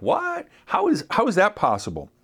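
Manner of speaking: mock disbelief, the voice leaping up; imitating a doubter's shocked question; incredulous. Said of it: "some of you are like".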